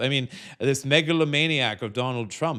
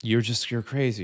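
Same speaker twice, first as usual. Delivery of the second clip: normal talking voice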